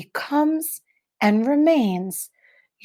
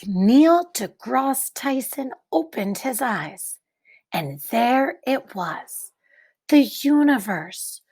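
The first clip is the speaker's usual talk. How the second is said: put-on reading to children; 2 semitones higher than usual. Teacher reading a picture book to the class, hushed and wondering.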